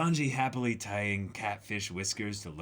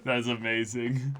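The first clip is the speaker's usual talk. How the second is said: usual speaking voice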